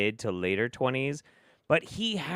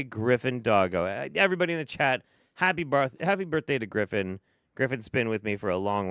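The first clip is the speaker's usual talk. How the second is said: ordinary delivery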